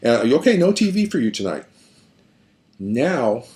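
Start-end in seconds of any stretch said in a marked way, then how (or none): 0.0-1.6 a parent snapping back, going higher; mock scolding of a hostile child, pitch jumping up; fed-up parent. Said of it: "and so say".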